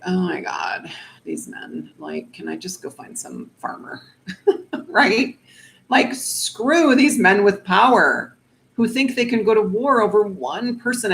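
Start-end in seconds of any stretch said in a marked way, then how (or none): 0.0-4.0 mock-weary, under-the-breath complaint. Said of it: "a [name] kind of way". 6.1-11.1 higher, louder fed-up outburst; mock-exasperated rant; annoyed.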